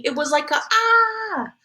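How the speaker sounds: put-on high kid voice with small wail; demonstrating a scream. Little Jamie shows mom his cry, mock wailing. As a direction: mimic a scream in a little boy's voice.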